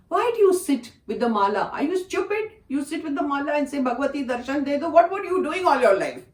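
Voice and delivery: high, loud, passionate rebuke; fiery scolding. Retold or imitated. Guru scolding devotee for her mala prayers, fiery and loud. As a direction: scold her with real fire, pitch climbing. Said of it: "with the kind of fire he was talking".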